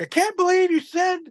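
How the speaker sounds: high-pitched aghast boss, imitated; scandalized disbelief. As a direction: mimic a CEO who can't believe it. CEO's disbelief, acted.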